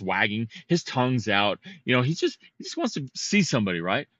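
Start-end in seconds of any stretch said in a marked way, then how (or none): none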